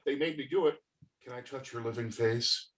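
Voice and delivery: creepy soft. Acted. Ted turns creepy.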